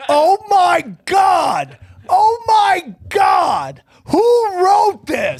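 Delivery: high, very drawn-out shout; appalled outburst. Real, not acted. Reacting to a cringey recruitment ad, appalled shouting. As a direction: wail it slowly in disbelief.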